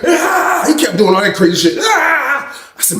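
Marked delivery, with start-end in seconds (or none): 0.1-0.6 high imitated battle cry; mock crazed scream. Mimics Billy Bob's crazed cries. 1.8-2.5 high imitated battle cry; mock crazed scream. Mimics Billy Bob's crazed cries.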